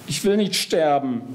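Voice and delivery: high-pitched, pleading whine; whining. A narrator's reading. Geist begging the three for his life, whining. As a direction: wail it lightly, pitch well up. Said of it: "jammert Geist".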